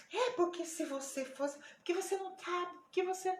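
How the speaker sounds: retold worked-up objections; imitated agitation. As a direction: mimic her arguing in broken-off bursts. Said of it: "começou a se alterar e falar coisas".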